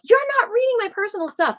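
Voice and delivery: high, fast tantrum outburst; temper tantrum. Retold or imitated. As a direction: rush it out high-pitched, an indignant kid. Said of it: "like, a temper tantrum".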